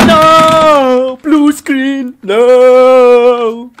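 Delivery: loud high wailing no; real anguish at the blue screen; anguished shout. Real, not acted. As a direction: scream in despair.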